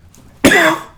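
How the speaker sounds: sudden harsh dusty cough; coughing on dust. Real, not acted. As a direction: hack out a dry dust cough. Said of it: "Oh, I got a cough".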